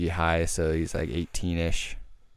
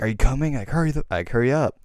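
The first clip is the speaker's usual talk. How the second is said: high, louder urgent call; urgent hurry-up; 6 semitones higher, 3 dB louder, faster than usual. Retold or imitated. Son at the front door urging his dad outside, urgent and high. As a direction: hurry him out the door, pitched up.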